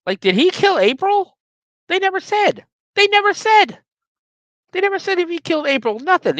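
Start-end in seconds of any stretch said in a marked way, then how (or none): 1.9-6.4 high, indignant disbelief; venting, pitched way up in outrage; how could they never say; exasperated rant. Real, not acted. Ranting about the movie's ending.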